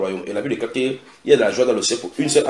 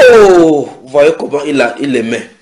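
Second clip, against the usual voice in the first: loud, drawn-out "oh" of wonder; awed crowd reaction; amazed onlookers; 4 semitones higher, 11 dB louder than usual. Acted. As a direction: exclaim in admiration, voice raised.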